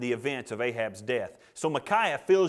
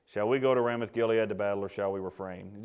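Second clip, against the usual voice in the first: reluctant asking; 7 semitones lower than usual. Acted. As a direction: ask as if you'd rather not. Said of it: "picture Ahab not wanting to ask".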